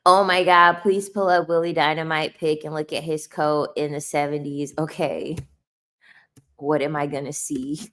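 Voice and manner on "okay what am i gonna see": usual speaking voice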